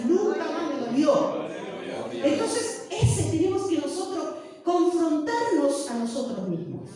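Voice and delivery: loud, high preaching climax. Acted. Pastor exhorting the brothers to confront themselves, loud preaching. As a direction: build the faith testimony to a shout.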